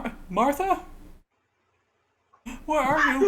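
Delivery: high, loud searching call; crying adult. Acted. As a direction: call out as a distressed adult hunting for someone. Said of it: "adult crying. And you hear, like".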